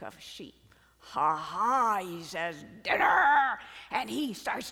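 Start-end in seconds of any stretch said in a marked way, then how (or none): 1.0-2.0 loud, triumphant ha-ha; a predator crowing over found prey; gloating hungry lion. Acted. Hungry lion spies the flock. 2.8-3.6 loud, hammed-up roar of delight; gloating hungry lion. Hungry lion spies the flock.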